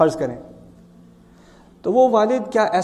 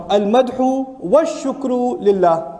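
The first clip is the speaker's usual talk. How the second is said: slow recitation; much slower than usual. Shown as a demonstration. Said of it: "وا کے معنی کیا ہے عربی میں".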